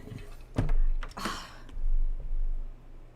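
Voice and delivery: long, breathy exhale; long sigh. Real, not acted. Streamer reacts after nobody has seen the movie, long sigh. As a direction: let out a drawn-out sigh.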